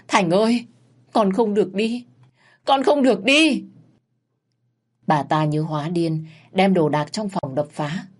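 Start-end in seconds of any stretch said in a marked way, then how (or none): 0.1-0.6 higher, louder wail for her son; louder anguished wail. Acted. Mother wailing for her dead son, anguished. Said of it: "Bà Hạn gào lên đau đớn". 1.1-2.0 high pleading wail; grieving plea. Acted. Mother begging her dying son, wailing. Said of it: "Bà Hạn gào lên đau đớn". 2.6-3.6 much higher, louder scream of grief; screaming wail. Acted. Mother screaming at her son's body, desperate. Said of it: "Bà Hạn gào lên đau đớn".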